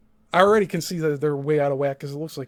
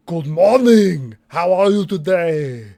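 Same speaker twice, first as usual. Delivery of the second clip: slow, louder, exaggerated polite greeting; put-on greeting voice; 6 dB louder, slower than usual. Shown as a demonstration. Streamer demos his acting range, slow and overdone polite greeting. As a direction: show off an acting voice, drawn out. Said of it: "I could do a bunch of different voices".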